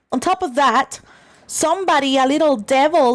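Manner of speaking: ordinary talk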